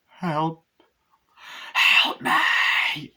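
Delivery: high, loud, airy mock scream; desperate cry for help. Laurie crawling and begging for help, imitated desperate scream. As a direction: build to an acted scream for rescue.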